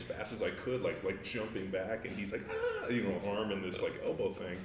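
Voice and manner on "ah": pained yelp. Retold or imitated. Opponent's arm being barred.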